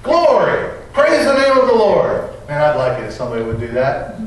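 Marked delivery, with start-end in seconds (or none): 0.0-2.2 high, ecstatic exclamation of praise; excited shout. Retold or imitated. Visitor in the parsonage reading and shouting, joyful. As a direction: shout it out in sudden joy. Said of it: "he shouted".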